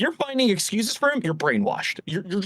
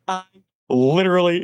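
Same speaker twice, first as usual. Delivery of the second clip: usual speaking voice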